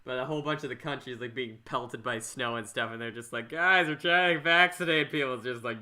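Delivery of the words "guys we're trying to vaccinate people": raised, louder voice, pleading to everyone; hammed-up cry of officials begging people to listen; mock exasperated plea. Officials pleading with the snowed-in country, mock exasperated plea.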